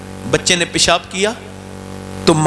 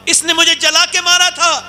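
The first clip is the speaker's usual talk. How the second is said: high, pained plea of a burned mother; mother's anguished complaint; 9 semitones higher than usual. Acted. Burned mother accuses her son before God, high and pained. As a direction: cry out your grievance to the Lord.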